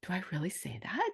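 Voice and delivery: unvoiced hushed whisper to yourself; whispered self-doubt. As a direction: whisper the worry under your breath. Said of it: "I'm like".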